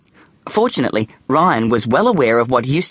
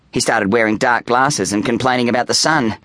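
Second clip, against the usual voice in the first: usual speaking voice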